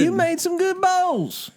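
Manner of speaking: slow, very high mock Southern accent; high, drawn-out Southern coach praise; Rhule, Southern accent. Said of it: "Rhule talking in a Southern accent".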